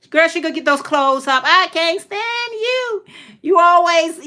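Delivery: high, exasperated mother impression; mom scolding. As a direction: wail the complaint like a fed-up mom.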